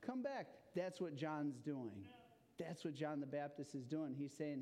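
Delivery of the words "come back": high-pitched warning cry; acted yell of alarm, not full volume; urgent yell. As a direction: call someone back from danger, pitch way up. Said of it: "So you would yell, right?".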